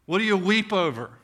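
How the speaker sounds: usual speaking voice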